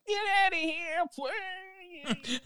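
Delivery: very high mock old lady voice; old woman shooing. Old woman shooing the monster with her broom, very high. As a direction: shoo the monster away like a granny. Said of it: "to just, like".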